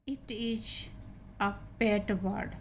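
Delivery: slow, separated words; slow reading aloud. Shown as a demonstration. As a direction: read it out word by word. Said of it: "teacher reads slowly".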